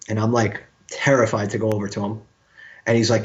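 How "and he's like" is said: ordinary delivery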